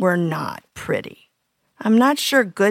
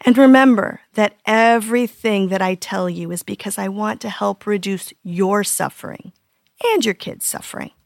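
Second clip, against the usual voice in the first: normal talking voice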